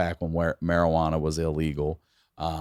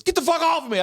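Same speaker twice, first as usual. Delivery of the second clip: much higher put-on voice of a startled cousin; mock cousin's yell; 3 dB louder, faster than usual. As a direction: squeal it like the cousin waking up mid-CPR. Cousin waking up from CPR.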